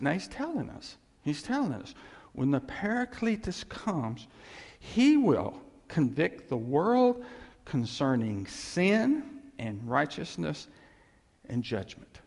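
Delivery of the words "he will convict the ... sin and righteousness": slow and weighty, louder on he will convict; emphatic scripture reading. Acted. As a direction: lay each word down slowly.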